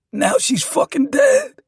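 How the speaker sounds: high, loud, drawn-out cry; wailing, slowed lament; anguished outburst. A narrator's reading. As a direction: cry out in grief and regret. Grieving man cries out his regret.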